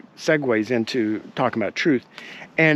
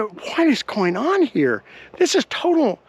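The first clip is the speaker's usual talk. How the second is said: high-pitched, incredulous retold outburst; retold disbelief; 8 semitones higher, slower than usual. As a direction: replay your own appalled disbelief, voice pitched up. Retelling his shocked reaction.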